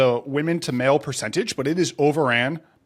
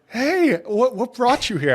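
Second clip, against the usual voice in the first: put-on sweet older woman; 8 semitones higher, slower than usual. Older woman chatting again.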